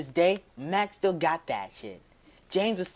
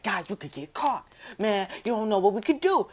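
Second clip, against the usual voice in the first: higher, hammed-up panicked friend; mock-worried [name]; 6 semitones higher than usual. [name] joking to the guys, a higher mock panic. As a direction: play up the fake worry, pitched higher. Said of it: "He was joking around".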